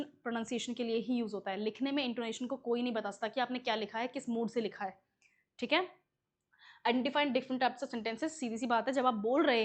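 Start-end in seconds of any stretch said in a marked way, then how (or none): none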